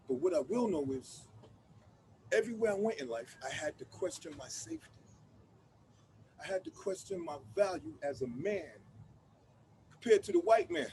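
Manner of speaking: quiet and bitter. Acted. Telling the audience about his fear, quietly. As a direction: drop down into quiet hurt. Somber reflection in monologue.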